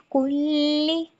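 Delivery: कुल्ली with lips rounded on the u. Shown as a demonstration. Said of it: "होंठों को गोल करके". (कुल्ली होंठ गोल करके)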